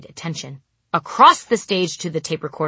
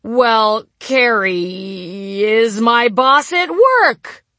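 improvised loud line; 6 semitones higher, 7 dB louder, much slower than usual. Retold or imitated.

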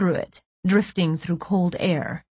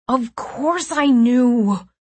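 drawn-out, high protest; exasperated; 5 semitones higher, 3 dB louder, much slower than usual. A narrator's reading. Nico answering about the plans, exasperated. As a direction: stretch it out in slow exasperation.

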